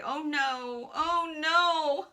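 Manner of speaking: builds to high, loud comic lament; wailing man. Acted. Fallen man dreads his wife's anger, high loud wailing. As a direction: wail as if disaster has struck. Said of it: "continuing to say".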